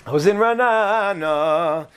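Ra'anana stretched out long and slow; slow it down, lingering on the vowels of Ra'anana; drawn-out repeat. Real, not acted. Repeats place name, stretching it out.

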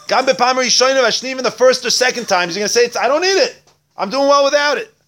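high, loud, declaimed text teaching; preaching at full force. Real, not acted. Rabbi reading the text aloud and expounding it, full force. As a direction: belt out each phrase, far above normal speech.

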